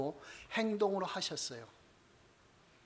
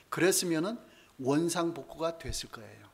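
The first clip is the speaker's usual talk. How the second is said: ordinary talk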